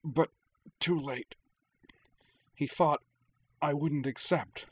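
pushed out through gritted teeth; strained words through gritted teeth. A narrator's reading. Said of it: "said through gritted teeth".

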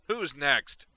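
loud, high villain's taunt; gloating challenge. Acted. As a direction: call out the next victim with relish.

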